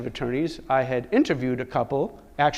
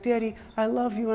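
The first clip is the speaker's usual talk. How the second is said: lightly voiced little boy, high-pitched; a hint of a small child on the phone; young son; 7 semitones higher than usual. Son tells Dad he loves him, lightly childlike. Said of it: "speak to Jonathan".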